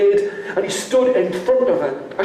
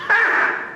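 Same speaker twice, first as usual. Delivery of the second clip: scornful ha. Acted. Father's scornful laugh.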